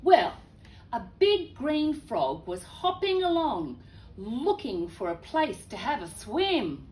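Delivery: lively read-aloud storytelling voice; telling a picture book to children; story narration. A narrator's reading.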